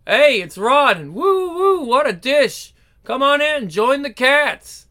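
loud, high and whooping, slow drawn-out cool talk; rowdy hepcat welcoming the newcomers; excited party welcome. Acted. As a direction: whoop at the pretty girl like a hepcat. Party host greets arrivals.

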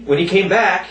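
ordinary talk

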